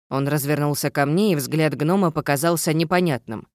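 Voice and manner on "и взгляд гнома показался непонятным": usual speaking voice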